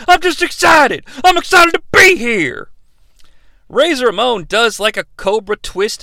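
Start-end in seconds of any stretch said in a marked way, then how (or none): 0.1-2.7 high, shouted excitement; mock Jim Ross gushing; hammed-up excited shout; excited outburst. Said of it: "J R's like".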